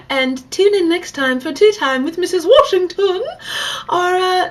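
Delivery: hammed-up show outro; show sign-off.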